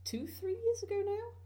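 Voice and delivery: drawn-out, high questioning rise; unsure questioning. Real, not acted. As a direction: trail up high and slowly into a question. Unsure how long she has played.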